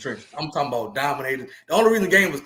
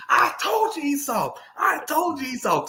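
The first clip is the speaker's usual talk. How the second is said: gloating taunt; 10 semitones higher, slower than usual. Retold or imitated. Coach gloating after the tackle.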